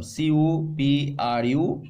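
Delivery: slow letter-by-letter spelling of cuprum; slow, clear dictation for the class. Shown as a demonstration. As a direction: say CU P আর U M slowly, each letter apart. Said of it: "cuprum".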